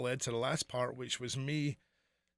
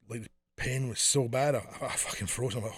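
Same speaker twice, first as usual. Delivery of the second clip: normal talking voice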